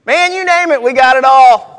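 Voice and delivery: loud and high, belting it out to the crowd; preaching shout. Acted. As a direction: shout the punchline like a revival preacher.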